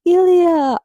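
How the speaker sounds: mock Russian accent, called out high and loud. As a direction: shout Ilya with a playful Russian accent. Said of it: "saying Ilya with a Russian accent".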